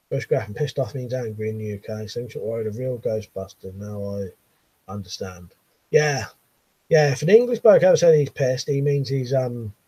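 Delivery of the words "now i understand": ordinary talk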